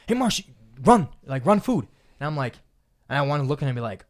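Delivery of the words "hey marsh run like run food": higher, louder, slow barked order; bossy coworker. Retold or imitated. Coworker ordering the delivery boy to run food, bossy. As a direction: order him around like a pushy coworker. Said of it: "my coworker, he's like".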